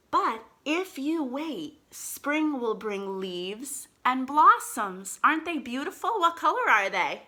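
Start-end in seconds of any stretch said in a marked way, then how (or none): 0.1-5.2 slow storybook reading aloud; reading to children. Acted. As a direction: read it slowly to little kids.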